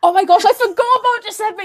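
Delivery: loud, high burst of dismay and delight; real excited outburst, high and loud; sudden excited realisation. Real, not acted. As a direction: exclaim as if it just struck you. Suddenly remembers a forgotten cast member.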